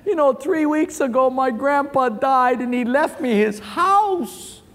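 high, whiny fake-tearful voice; hammed-up blubbering through bad news; mock crying. Crying man in a joke tells his friend, mock tearful. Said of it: "this guy who was crying".

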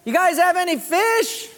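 high, carrying call. Acted. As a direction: holler it across the water to the boat. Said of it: "he calls out to the disciples".

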